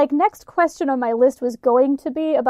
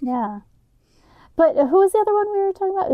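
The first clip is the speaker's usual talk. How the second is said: ordinary delivery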